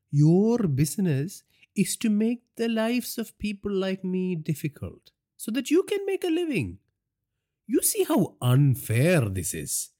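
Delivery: mocking crime boss eyeing his captive; gloating gangster boss. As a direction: savour it like a cruel boss. Villain voice for Naresh.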